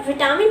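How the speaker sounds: vitamin with a long "taa" in the middle; incorrect pronunciation. Shown as a demonstration. Teacher shows learners the incorrect form, vi-TAA-min for vitamin. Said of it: "that is not correct".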